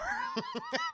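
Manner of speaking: high burst of laughter. Real, not acted. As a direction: crack up.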